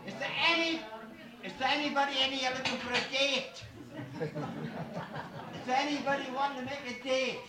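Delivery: auctioneer. Acted. Auctioneer calls out loudly.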